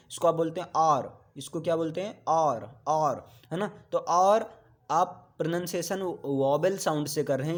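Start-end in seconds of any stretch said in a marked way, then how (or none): 2.3-2.8 और said like "our", with no h at the start; hour said as और, with the h left out; silent h pronunciation. Shown as a demonstration. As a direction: model और, the h-less way to say hour. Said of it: "इसको हावर नहीं बोलते हैं".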